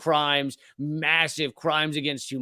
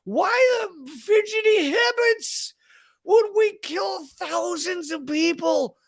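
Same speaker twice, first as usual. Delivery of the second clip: squeaky outraged shout; slower than usual. Shown as a demonstration. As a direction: scream the question in disbelief. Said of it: "it should be squeaky".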